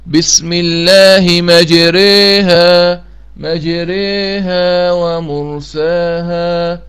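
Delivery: مجريها drawn out slowly in chant, ra vowel tilted toward e; recited with imala. Shown as a demonstration. Teacher models the imala for students, slow chanted recitation. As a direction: intone مجريها with the a leaning to e, very slow. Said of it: "وتقال بهذه الصورة".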